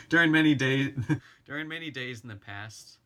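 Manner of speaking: giggling through the words; laughing. Real, not acted. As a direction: laugh while rereading the line. Laughs through a reread line.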